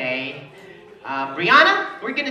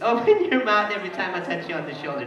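ordinary talk, nothing marked

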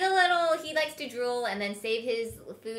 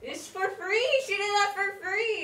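teasing; 10 semitones higher, 4 dB louder, slower than usual. Real, not acted. Teasing about showing feet.

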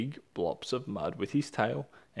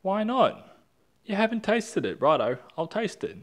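high protest; 10 semitones higher, 5 dB louder than usual. Acted.

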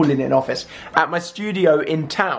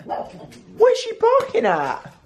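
surprised; much slower than usual. Real, not acted. Dog barking suddenly.

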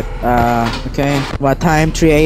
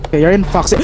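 ordinary talk

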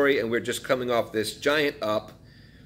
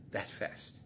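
soft unvoiced whisper, much quieter; 4 semitones lower, 16 dB quieter than usual. Real, not acted. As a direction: breathe the words out as a whisper. Stressing sudden collapse.

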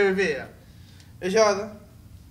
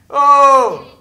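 loud surprise; 4 semitones higher, 12 dB louder, much slower than usual. Real, not acted. Loud exclamation.

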